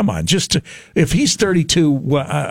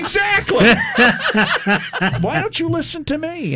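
laughing. Real, not acted. Laughing while teasing co-host.

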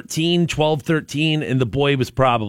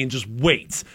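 usual speaking voice

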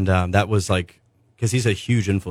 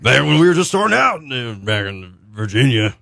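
rambling, animated mock stage banter. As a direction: ramble loosely like a singer chatting between songs. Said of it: "he's like".

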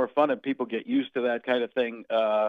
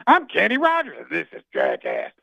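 a goofy country-singer parody; 5 semitones higher, 5 dB louder than usual. Retold or imitated.

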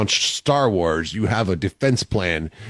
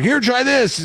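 pushy mom voice; 14 semitones higher, slower than usual. Retold or imitated. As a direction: push food on him like mom. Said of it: "she's like".

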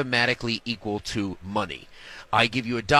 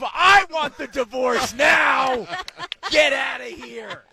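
much higher, louder, slow triumphant yell; hammed-up gloating to the wife; gleeful mock shout; 13 semitones higher, 6 dB louder, slower than usual. Retold telling wife off, gleeful.

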